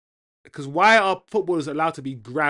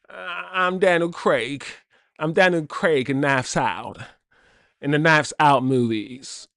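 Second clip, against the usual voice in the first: usual speaking voice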